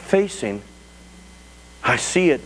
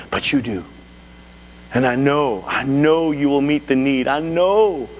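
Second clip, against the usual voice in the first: humble prayer. Shown as a demonstration. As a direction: pray from helplessness with long pauses, growing surer. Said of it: "here's what I'm doing".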